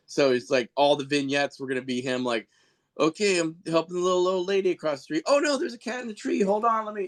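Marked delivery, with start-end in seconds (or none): 3.0-7.1 high, cheery do-gooder hero voice; goofy superhero voice. Retold or imitated. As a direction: play a clueless hero, chirpy and eager. Parodying the worst superhero.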